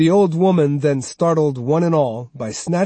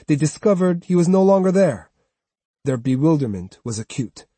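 ordinary delivery, nothing marked

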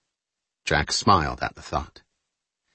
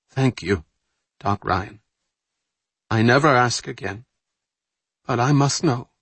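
put-on accented English; 4 semitones higher than usual. Carol presses Ryan.

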